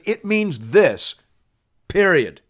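high, slow, emphatic declaration; authoritative pronouncement. Acted. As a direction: lay down the final word, punching each part. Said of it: "never heard anybody talk like that".

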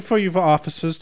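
ordinary talk, nothing marked

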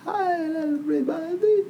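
high-pitched cartoon greeting; Simpsons greeting impression. Cohost echoing the old Simpsons opening, high cartoon voice. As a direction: echo the cartoon hello up high. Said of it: "we used to open with The Simpsons".

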